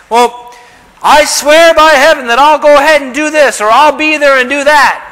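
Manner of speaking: a hammed-up hypocrite vowing by heaven; mock Pharisee. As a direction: play the glib Pharisee swearing lightly. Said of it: "This is the Pharisees now talking".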